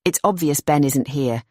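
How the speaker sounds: usual speaking voice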